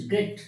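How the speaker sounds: গ্রেট said in one go; single syllable. Shown as a demonstration. Teacher showing a one-syllable word, গ্রেট said all at once. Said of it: "গ্রেটটা কিন্তু একবারেই উচ্চারণ হচ্ছে".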